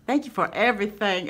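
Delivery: high, recovering from hard laughter; giddy, high-pitched real delight; tears of joy. Real, not acted. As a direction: gush thanks while getting over a laughing fit. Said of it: "tears of joy in my eyes".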